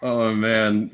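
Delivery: usual speaking voice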